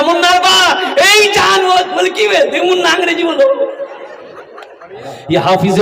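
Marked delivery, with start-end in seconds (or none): 0.0-3.7 mother calling husband. Retold or imitated. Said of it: "शोहर को आवाज दिया".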